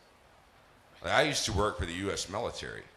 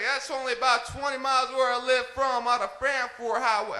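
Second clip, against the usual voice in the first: Texan sergeant; 6 dB louder than usual. Retold or imitated. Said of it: "He's like".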